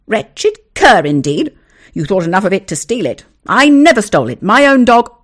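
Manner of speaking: loud, high angry retort; furious retort. Acted. Owner retorting to the neighbour, loud and furious. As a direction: snap back in outrage. Quarrel continues.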